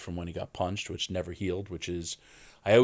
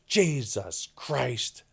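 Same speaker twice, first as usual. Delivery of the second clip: exasperated mom; 5 semitones higher, much slower than usual. Retold or imitated. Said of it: "my mother goes".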